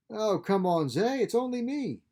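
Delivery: high, bright sister voice, teasing; Nuala's voice. A narrator's reading.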